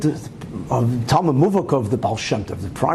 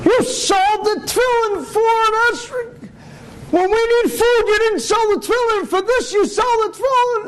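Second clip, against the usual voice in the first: high, shrieking outraged wife; wife flying off. Retold or imitated. Wife blowing up at her husband over the sold tefillin, high angry shriek. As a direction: rage at him in a high, incredulous shout. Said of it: "she flew off".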